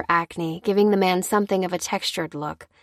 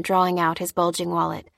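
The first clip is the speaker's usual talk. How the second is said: plain reading voice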